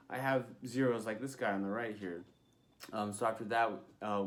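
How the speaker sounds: usual speaking voice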